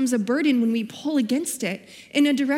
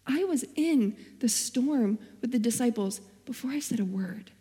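God's gentle answer; 5 dB quieter than usual. Retold or imitated. God answering her complaint, soft and tender. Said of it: "And he told me".

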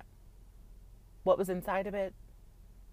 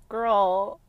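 put-on exasperated girl no; 5 dB louder, much slower than usual. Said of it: "I was like".

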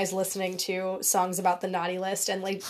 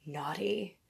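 slow, quieter chain-smoker rasp; Chainsmoker voice; 10 dB quieter than usual. Pretend. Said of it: "in my Chainsmoker voice".

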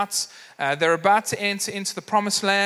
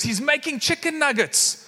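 higher-pitched mock excitement; excited kid; 6 semitones higher than usual. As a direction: imitate a kid thrilled about nuggets. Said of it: "these kids are getting so excited".

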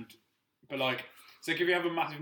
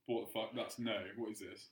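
confused body voice; 3 semitones lower, 9 dB quieter than usual. Pretend. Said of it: "your body would just be like".

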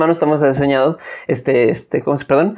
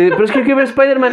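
mock whiny kid; 9 semitones higher, faster than usual. Narrator voices his younger whining self.

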